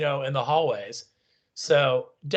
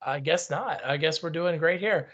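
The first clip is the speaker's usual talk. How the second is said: ordinary talk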